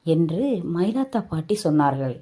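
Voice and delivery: usual speaking voice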